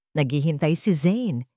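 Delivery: ordinary talk, nothing marked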